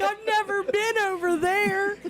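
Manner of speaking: high wailing retold cry; playfully reliving her crying in the store; mock crying. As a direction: ham up the teary wail. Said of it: "I started crying".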